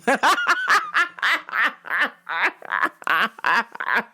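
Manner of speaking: high staccato laugh trailing on and on; helpless, repeated bursts of cackling; long cackle. Real, not acted. Storyteller laughing at own boast, long cackle.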